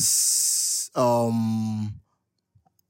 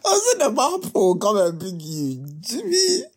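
laughing; 7 semitones higher than usual. Real, not acted.

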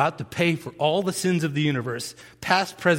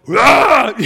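huge beast-mode roar; full-throated battle roar; 8 semitones higher, 11 dB louder than usual. Retold or imitated. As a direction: roar like a charging lineman. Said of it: "and I was like".

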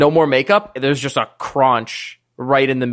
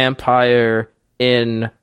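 slow dictation; 5 semitones lower, much slower than usual. Acted. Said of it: "starts writing that down and goes".

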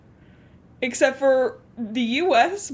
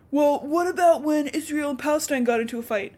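higher-pitched mimicry of a clueless question; mock naive objection; 5 semitones higher than usual.

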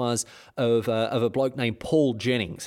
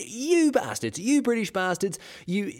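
higher-pitched hammed-up outrage; mock furious tirade; 9 semitones higher than usual. Superintendent Thornton abusing British soldiers, high hammed-up fury. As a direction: tear into them in a squeaky rage.

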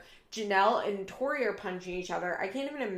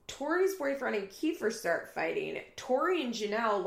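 ordinary delivery

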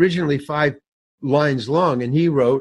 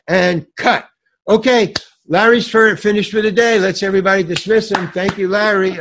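high, loud announcement to the crew; director calling out; 5 semitones higher, 5 dB louder than usual. Retold or imitated. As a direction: briskly dismiss the actor before everyone. Imitating director dismissing him on set.

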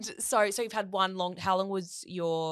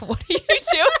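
high, loud laughing complaint; laughing exasperated; 9 dB louder than usual. Retold or imitated. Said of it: "I'm like".

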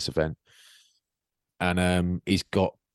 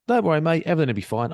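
higher, brisk no-worries reassurance; breezy reassurance; 8 semitones higher, 3 dB louder, faster than usual. Retold or imitated. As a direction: brush it off as your easygoing usual self. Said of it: "normally I'm like".

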